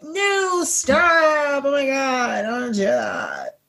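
high squeal falling into a slow croak; exaggerated vocal fry. Shown as a demonstration. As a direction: overdo it, ending in vocal fry. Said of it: "I exaggerated, like, I'll get the vocal fray and everything".

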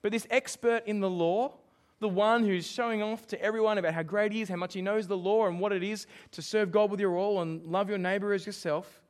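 ordinary talk, nothing marked

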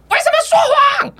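very high, sharp mock scolding; mock angry scold. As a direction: mom slapping and scolding again. 妈妈打完又骂. (妈妈边打边尖声责骂)

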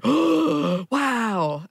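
wide-eyed oh wow; amazed gasp. Retold or imitated. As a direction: act dazzled by the surprise.

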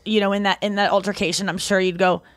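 normal talking voice

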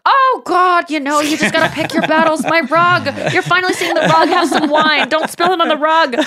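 a hint of a breezy host showing off her rug; airy Jennifer Aniston. Aniston character voiced in retelling.

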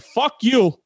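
high, loud snapped curse; retold defiance, shouted up high; defiant yell. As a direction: fling it at the trooper, loud. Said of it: "I said".

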